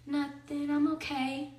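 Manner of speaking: put-on child's soft mumbled answer; lightly voiced son, hushed and withdrawn; a hint of a dazed little boy, quiet; small quiet voice.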